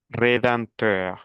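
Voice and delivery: slow "Rédempteur" with the "em" nasalized; nasalization. Shown as a demonstration. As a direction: model the nasal vowel in "Rédempteur", taking it slowly. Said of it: "nasalización". ("Rédempteur" despacio, con nasalización)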